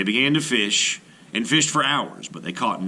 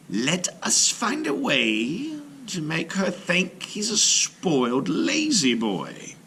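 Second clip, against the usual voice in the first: slow, higher sly scheming voice; scheming brother; 8 semitones higher, slower than usual. A narrator's reading. Jealous brother schemes against Maui, slow and sly. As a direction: plot it out slowly, conspiring.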